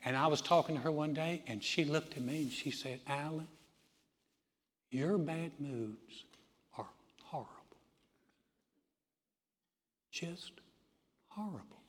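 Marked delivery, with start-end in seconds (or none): 3.0-11.8 very slow, hushed Southern drawl, sinking to a whisper; Southern accent. Retold or imitated. Admin gently rebuking the preacher, slow hushed Southern drawl. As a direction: imitate a soft-spoken Southern lady, long pauses between phrases. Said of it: "She talked like this".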